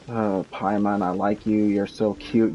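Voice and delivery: normal talking voice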